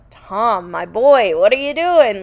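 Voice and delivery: lightly voiced stern dad calling his boy out; Ken scolding Tom. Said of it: "see Ken come in a little bit and be like".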